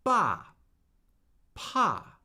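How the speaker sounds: "Ba" and "pa" falling sharply from high; fourth tone. Shown as a demonstration. Teacher drilling the fourth tone, sharp fall on "Ba, pa". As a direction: start each "Ba, pa" high and drop fast. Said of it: "the falling tone".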